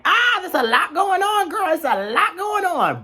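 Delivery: put-on high, sing-song "there's a lot going on, girl"; overwhelmed sassy voice. Vlogger mimicking her overwhelmed self, high and sassy. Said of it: "was kind of just like".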